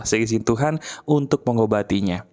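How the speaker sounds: normal talking voice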